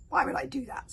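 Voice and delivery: scornful dragon retort. A narrator's reading. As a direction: throw the question back with scorn. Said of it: "Scorn Furious".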